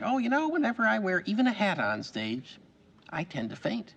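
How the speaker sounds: pointed remark passed off as chat; imitated colleague. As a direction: imitate a colleague's passive-aggressive confession. Imitating another fellow actor's remark.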